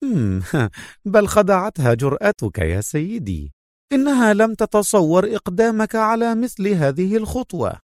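short chuckle into a high female voice; amused, laughing. A narrator's reading. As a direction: laugh lightly, then admire him in high pitch. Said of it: "ضحكت منى وقالت". (ضحكة قصيرة ثم صوت نسائي مرتفع)

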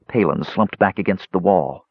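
ordinary delivery, nothing marked

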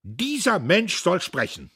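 loud, high, slowed warning cry; the old man hurls his final warning; last cry of conscience. A narrator's reading. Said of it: "zu dem letzten Schrei seines Gewissens".

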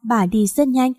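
ordinary delivery

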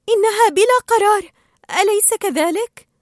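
high, loud female fish voice; Dory's chirpy voice. A narrator's reading. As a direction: chirp it up high, ending on a question. Cheerful Dory voiced in story.

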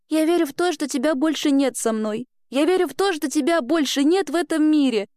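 put-on high-pitched, earnest child's voice; fervent girl. Girl at the cross addressing the lost twin, fervent. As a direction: declare it high, with belief.

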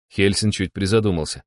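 plain reading voice

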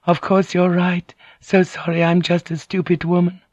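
high-pitched humble apology, a touch tearful; penitent, tearful. A narrator's reading. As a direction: grovel gently in a high, contrite voice. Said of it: "her voice now penitent and overlaid with tears".

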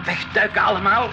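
sudden alarmed command; urgent shout. Acted. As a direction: yell the order to duck down. Helicopter approaching, urgent order.

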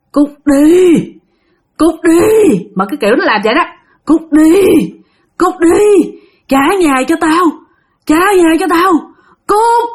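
high, loud snarling spirit voice; possessed growl. Acted. Possessed boy snarls at the household, high and loud. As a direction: growl at them to get out. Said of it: "tự nhiên nó gầm gừ lên".

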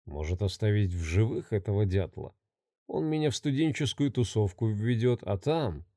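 low, soft scheming murmur. Acted. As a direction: murmur like a plotting killer. Maniac's inner scheming thoughts.

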